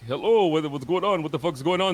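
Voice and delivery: imitating a friend who is upset and demanding answers; agitated retold shout. Ray angrily questions him on phone.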